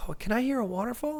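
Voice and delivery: put-on a sick boy hearing water he longs for; dying child's voice. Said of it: "And he goes".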